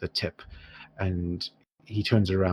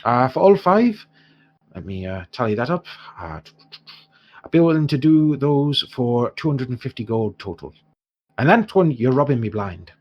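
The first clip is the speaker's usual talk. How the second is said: lightly Irish dwarf trader, lifted openings; haggling dwarf shopkeeper; 6 semitones higher, 6 dB louder than usual. Acted. Dwarf quotes heroes his whole stock, lightly voiced and protesting. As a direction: haggle as a put-upon dwarf.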